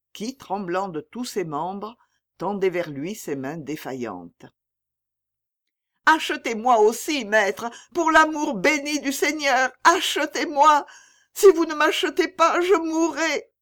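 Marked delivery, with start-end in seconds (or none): 6.1-13.5 high, loud, desperate begging; desperate pleading. A narrator's reading. Old mother begging her son's buyer, high desperate plea. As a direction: beg as if life depends on it.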